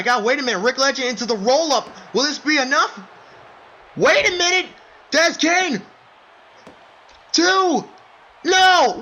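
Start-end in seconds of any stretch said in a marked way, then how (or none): 4.0-9.0 high, loud, breathless yelling; frantic shouting. Acted. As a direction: scream every near fall. Frantic back and forth pinfall counts.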